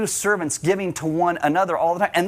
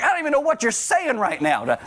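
higher-pitched mock bafflement; comically bewildered, pitch raised; baffled retelling; 8 semitones higher than usual. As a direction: act out being lost by a child's chatter. Acting out bafflement at kids' speech.

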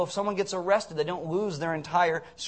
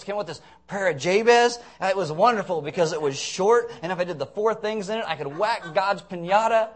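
high, loud mock bragging; sarcastic; 4 semitones higher, 5 dB louder than usual. American believer bursting in at heaven's dinner, sarcastic. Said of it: "I'm gonna be really sarcastic".

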